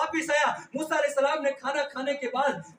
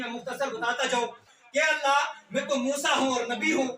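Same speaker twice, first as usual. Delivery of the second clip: normal talking voice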